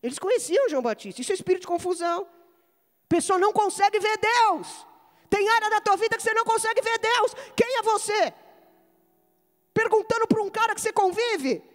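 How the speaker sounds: high, ringing exhortation; full-force preaching. Real, not acted. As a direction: preach it out with conviction.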